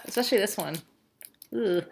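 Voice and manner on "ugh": ordinary delivery, nothing marked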